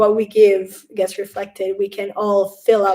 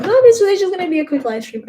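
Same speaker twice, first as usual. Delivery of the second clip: much higher and louder, breezy and sure; mock self-assurance; 9 semitones higher, 7 dB louder than usual. She quotes promising herself a quick stream, high and loud. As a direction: brush it off confidently.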